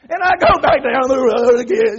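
hammed-up worked-up yelling; exasperated holler. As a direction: holler as if driving on furious. Acts out driving on, still mad.

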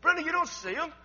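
high, loud retold exasperation; a little frustrated. Brother-in-law asking his wife about unseen friends, frustrated. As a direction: raise the voice at her in disbelief. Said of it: "he gets a little frustrated".

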